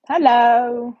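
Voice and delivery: loud, projected hello; big, loud greeting; called-out greeting. Real, not acted. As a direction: call out hello to arriving viewers. Calling out greeting to viewers.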